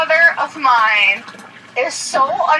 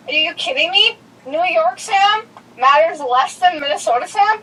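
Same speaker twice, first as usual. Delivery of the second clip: normal talking voice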